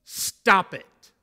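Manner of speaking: sharp loud shout, high and forceful; angry rebuke. Retold or imitated. Jesus rebuking his disciples, a loud angry shout. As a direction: bark it to stop them cold. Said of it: "when he said".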